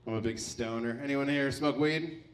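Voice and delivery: ordinary delivery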